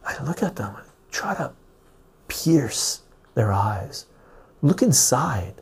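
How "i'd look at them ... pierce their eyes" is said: whispered, then slow and drawn out; intense whisper. Acted. Host tells viewers how he stares people down, whispering slowly. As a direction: whisper as if peering into a soul. Staring into someone's eyes.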